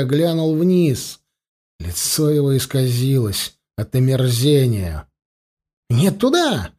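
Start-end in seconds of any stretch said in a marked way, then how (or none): 5.9-6.7 high squealed cry; shriek. A narrator's reading. Elf recoiling from the ravine edge, shriek. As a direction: squeal it in alarm, high-pitched. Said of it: "взвизгнул он".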